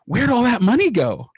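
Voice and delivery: wife's imitated panic over the empty bank account; mock panicked wife. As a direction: play the wife suddenly worried about the money. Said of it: "she's panicked".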